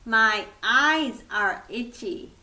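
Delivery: usual speaking voice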